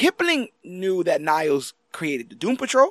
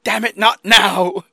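slow, louder mock irritation; annoyed; 3 semitones higher, 6 dB louder, much slower than usual. Host mimics Niles snapping at a call, slow and loud. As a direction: drag it out like an exasperated old man. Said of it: "He's like".

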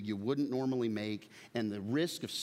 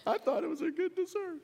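high, laughing whimper; mock teary excuse. Dad retelling his answer to his daughter, mock tearful. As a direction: whimper it out much higher. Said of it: "I'm like".

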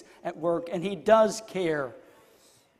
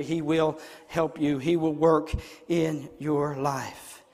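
solemn preaching; 3 semitones lower than usual. Real, not acted. Preacher assuring congregation God will work, low and solemn.

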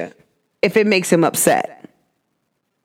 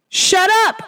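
a high snapped shut up, half in jest; higher mock shut up; 9 semitones higher than usual. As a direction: snap it at the noisy class. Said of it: "I would prefer you to say".

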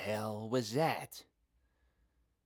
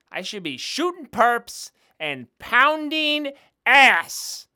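frustrated outburst; 12 semitones higher, 11 dB louder than usual. Acted. Resenting desk duty.

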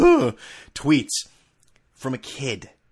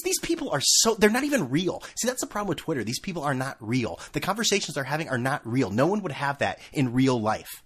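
normal talking voice

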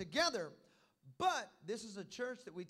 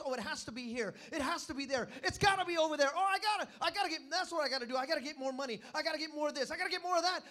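frantic mock search; 5 semitones higher, 7 dB louder, faster than usual. Mocking frantic Christians.

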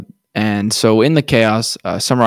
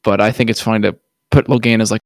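ordinary talk, nothing marked

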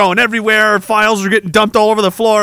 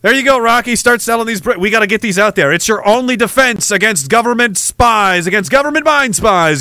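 usual speaking voice

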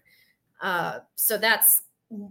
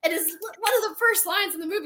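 indignant; 10 semitones higher, 5 dB louder than usual. Real, not acted.

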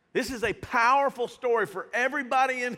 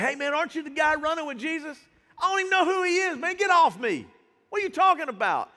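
higher, louder, fast protest; panicked denial; 7 semitones higher, 4 dB louder, faster than usual. Retold or imitated. Peter denying Jesus to the crowd, fast panicked protest. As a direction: shove them off in a rush.

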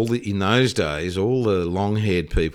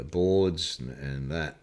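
usual speaking voice

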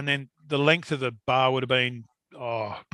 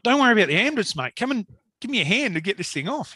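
higher-pitched, insistent appeal; retold urgent plea; 7 semitones higher, faster than usual. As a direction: act out urging a hesitant helper. Trapped man urging his reluctant rescuer.

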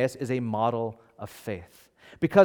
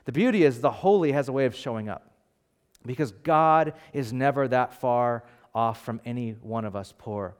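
ordinary delivery

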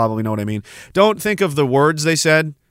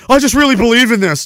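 mock earnest; 10 semitones higher, 5 dB louder, faster than usual. Mocking an earnest believer.

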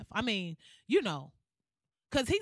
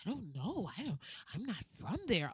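much softer, mumbled mock dodge; evasive denial; 6 semitones lower, 10 dB quieter than usual. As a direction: imitate someone vaguely disowning their hometown. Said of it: "try to act like".

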